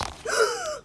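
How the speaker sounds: high, soft dramatic gasp; sharp gasp. Acted. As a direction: gasp in mock shock. Dramatic reaction during story.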